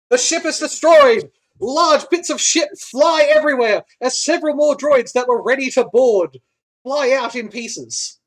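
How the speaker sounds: loud, high battle narration; big climactic announcement of destruction; dramatic game master. Acted.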